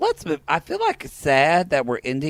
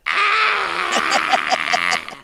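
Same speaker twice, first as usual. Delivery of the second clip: high, drawn-out mock wail; long high "ah" held out; imitated cry. Mimicking her for the co-host, high mock wail. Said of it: "And she goes".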